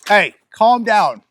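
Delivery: loud, high and slow; telling the dog off. Real, not acted. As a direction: stop the dog in the middle of talking. Dog going nuts mid-video.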